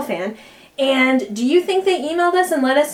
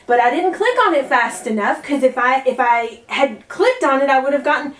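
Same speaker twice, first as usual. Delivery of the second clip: usual speaking voice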